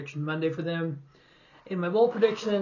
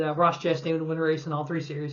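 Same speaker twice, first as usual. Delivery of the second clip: ordinary delivery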